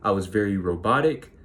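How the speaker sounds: ordinary talk, nothing marked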